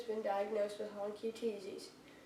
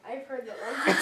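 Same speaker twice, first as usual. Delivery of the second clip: laughing; 8 dB louder than usual. Real, not acted. Retake breaks into laugh.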